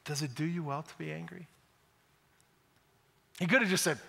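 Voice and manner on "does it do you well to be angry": gentle question. Acted. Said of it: "God so graciously says".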